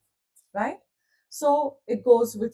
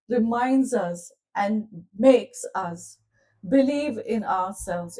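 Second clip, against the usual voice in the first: ordinary delivery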